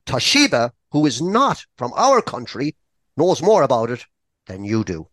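ordinary talk

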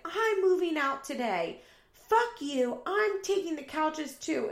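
high, loud mock-angry yell; retold screaming match. Roommate storming out of a fight, imitated angry yelling. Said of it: "while they're screaming at each other".

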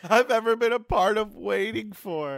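slow, high mocking repeat of his words; mocking echo. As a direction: parrot him back in a high, drawn-out voice. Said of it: "They were definitely the longest peas".

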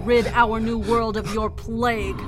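zealous cult leader declaring victory; fervent sermon. Acted. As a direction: preach it to the crowd at full force. Leader preaching over the carnage.

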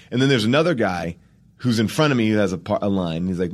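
normal talking voice

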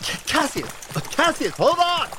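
very high, stammering yell; alarmed shout. Acted. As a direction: shout after a runaway partner. Calling after partner running off.

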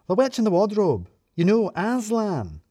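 excitable. A narrator's reading. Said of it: "Sue seemed a lot more excitable".

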